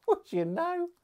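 high mock-amazed question; mock amazement. Real, not acted. Reviewer teasing the viewers about Walkers, high and mock-amazed. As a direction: squeak it with mock wonder.

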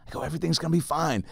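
breathy hushed reassurance; patronising whispered calm; mock whisper. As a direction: whisper it to needle him. Taunting the angry man softly.

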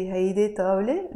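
fast, rising on طاولة; question intonation. Shown as a demonstration. Teacher showing the Arabic question tune. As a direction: ask by tune alone on طاولة. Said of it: "change the tonality of our voice".

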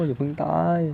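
a last anxious push of the plea; urgent plea. Real, not acted.

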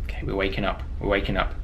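low soft murmur; hushed. Real, not acted. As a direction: keep it down so Granny won't hear. Game begins, hushed play.

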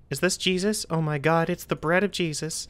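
ordinary delivery

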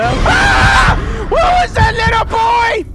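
high loud startled yell; frightened shout. Real, not acted. Player spotting a creepy little boy, startled yell. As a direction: yell at the sudden sight.

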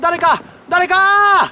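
slow, stretched cry for help; drawn-out call for help. Acted. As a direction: call out slowly for someone.

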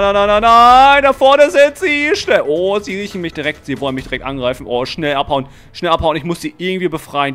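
usual speaking voice

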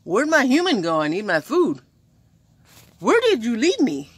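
high, loud, whiny dog voice; needy dog voice. Retold or imitated. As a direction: play a lost, hungry dog. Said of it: "they're pretty much like".

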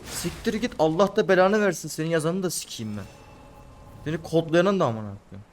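high, angry cursing at the game; furious cursing. Real, not acted. Gamer raging at the game's programmers, furious cursing. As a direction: vent the rage at the coders. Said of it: "çıkaramadım hıncımı".

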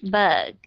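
Bug with a gentle, barely released G; low Bug with a softened ending; reduced final G. Shown as a demonstration. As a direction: let the G at the end of Bug fade lightly. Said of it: "đọc nó rất là nhẹ nhàng".

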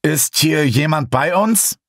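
höher, langsam und feierlich gefragt; bedächtig ins Dunkel fragen; ernster Ton. A narrator's reading. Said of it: "mit ernstem Ton sprach ich". (Sam fragt die Geister ernst und langsam)

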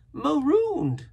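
high, loud cry in a castaway's voice; marooned castaway, aggrieved. A narrator's reading. Castaway telling the boy his fate, aggrieved. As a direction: wail the word out high and strong.

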